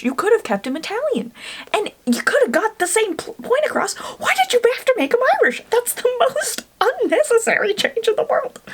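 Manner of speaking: high-pitched, indignant ranting; exasperated rant. Real, not acted. Book fan fuming over a show change, exasperated.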